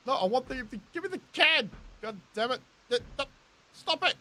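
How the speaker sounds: exasperated gamer losing it; frustrated yelling. Real, not acted. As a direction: yell at the screen in exasperation. Fighting game controls, frustrated.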